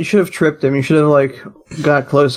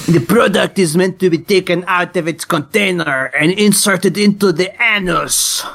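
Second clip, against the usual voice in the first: put-on French accent. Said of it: "pretend to be a French postmodernist".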